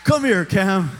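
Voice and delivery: loud mock summons; acted dad calling out. Dad calling his son over after the fight, loud acted call. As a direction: call the boy over at full volume. Dad's delighted reply, acted at volume.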